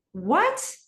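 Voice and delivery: usual speaking voice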